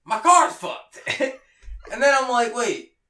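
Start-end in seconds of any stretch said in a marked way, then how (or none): 0.0-1.0 higher, louder dismayed cry; retold dismay. Realizing after the crash that her car is wrecked, dismayed cry. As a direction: cry out as it sinks in.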